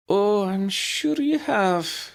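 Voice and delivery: slow, high and wry; a knowing, dry little jab. A narrator's reading. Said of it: "she said wryly".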